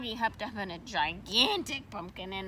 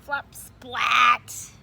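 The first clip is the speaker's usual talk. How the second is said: splat; 9 dB louder than usual. Acted. Landing sound.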